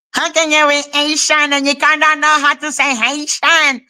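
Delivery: high, silly mimicking voice; mocking imitation. Mimicking a man questioning her being Haitian, mocking imitation. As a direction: parody his complaint way up high.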